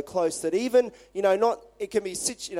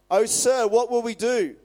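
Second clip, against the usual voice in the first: panicked servant; 4 semitones higher, 5 dB louder than usual. Retold or imitated. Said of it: "the young man cried".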